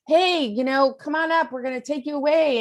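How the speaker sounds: mock call from helicopter. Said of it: "a helicopter comes by and is like".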